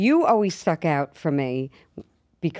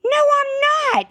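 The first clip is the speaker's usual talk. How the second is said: much higher and louder denial; mock protest; 5 dB louder than usual. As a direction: squeal the denial back at her. Heated denial.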